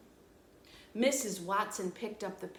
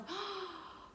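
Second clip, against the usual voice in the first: awed gasp; 11 semitones higher, 6 dB quieter than usual. Shown as a demonstration. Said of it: "It leaves you with a feeling of".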